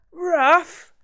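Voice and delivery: high, loud outraged yelp; screech. Acted. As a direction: shriek the word back in disbelief. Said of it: "Jack almost screeched".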